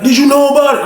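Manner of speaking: high, loud accusing question; betrayed inmate questioning the narrator's friend; confronting question. Retold or imitated. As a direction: demand it high and sharp.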